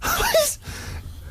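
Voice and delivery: squeaky unvoiced laugh; high breathy laugh. Real, not acted. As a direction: burst out in a high wheeze. Laughing at Karl's reply.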